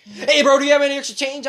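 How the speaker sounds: high, loud retold plea; imitated pushy beggar. Retelling a man approaching at the bus, imitated pushy begging. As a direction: hail a stranger urgently for change.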